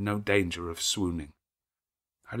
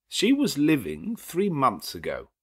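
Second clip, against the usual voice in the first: slow, deliberate and louder; slow lawyer counter; 6 semitones higher, 5 dB louder than usual. Acted. Lawyer counters Rochester, slow deliberate reply. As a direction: lay down the fact.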